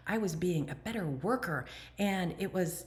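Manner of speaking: ordinary talk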